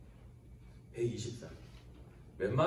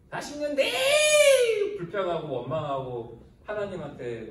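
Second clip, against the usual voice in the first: loud, slow, tearful cry; forty years of grumbling, shouted slowly; preaching shout; 4 semitones higher, 6 dB louder, slower than usual. Acted. Preacher crying out complaint.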